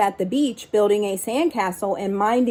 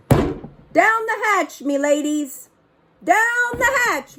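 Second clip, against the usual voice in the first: much higher, slow hammed-up pirate bellow; pirate yell; 10 semitones higher than usual. Braid Beard pounding the table at dinner, high hammed-up yell. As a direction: belt it like a rowdy captain at dinner. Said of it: "and yelled".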